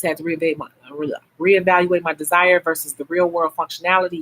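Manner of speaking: ordinary delivery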